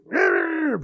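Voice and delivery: high, loud wordless squawk; mock loud "rrr" outburst; imitated speaking up. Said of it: "when I used to speak up".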